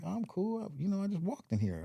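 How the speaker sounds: high-pitched, hurried objection; retold protest. Patient refusing the wheelchair, high quick protest.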